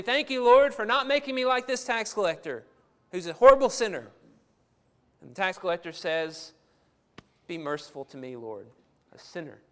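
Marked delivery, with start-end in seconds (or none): 0.0-4.1 louder and higher, fast and self-satisfied; smug Pharisee prayer. Retold or imitated. Preacher voicing the proud Pharisee, loud and smug. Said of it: "a Pharisee going to the temple to pray and saying". 7.5-9.6 much softer and lower, broken and pleading; humble plea. Retold or imitated. Preacher voicing the weeping tax collector, soft and pleading. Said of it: "you just picture him weeping as he's crying out".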